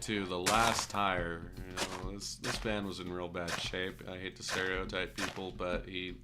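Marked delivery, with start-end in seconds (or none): none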